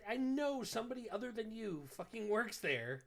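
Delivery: furious retold demand. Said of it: "I was furious".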